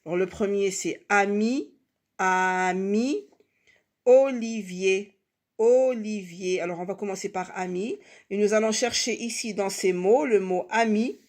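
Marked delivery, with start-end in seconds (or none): 2.2-3.3 AMI with a long held A, then MI; drawn-out syllables. Shown as a demonstration. Teacher introducing a label word, drawn-out syllables. 4.0-6.6 OLIVIER slowly, with a long held O; drawn-out syllables. Shown as a demonstration. Teacher introducing a label word, drawn-out syllables.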